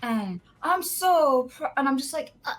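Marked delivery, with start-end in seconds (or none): 0.0-1.7 put-on drunk boss rambling. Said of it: "and he'd be like". 2.4-2.5 higher, hesitant humoring uh-huhs; awkward polite agreement. Retold or imitated. Thirteen-year-old humoring drunk boss, awkward polite uh-huhs. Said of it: "Just trying to be so polite".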